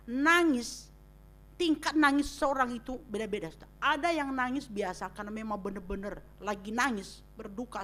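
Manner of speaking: normal talking voice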